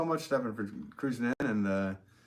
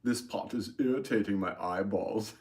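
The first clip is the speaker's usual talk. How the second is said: put-on Swedish accent. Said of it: "because he's with his Swedish accent".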